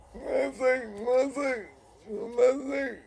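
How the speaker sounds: high deaf-speech impression; high deaf voice repeating the question; deaf friend voice. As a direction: echo it high and blurry.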